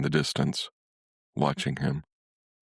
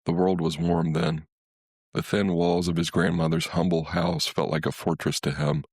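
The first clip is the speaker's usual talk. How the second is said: usual speaking voice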